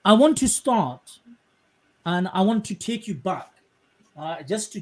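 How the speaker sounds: ordinary talk, nothing marked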